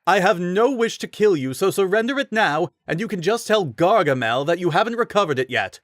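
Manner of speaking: high, haughty knight voice; condescending, high-voiced threat; pompous rider. A narrator's reading. Rider warning Link to surrender, high haughty voice.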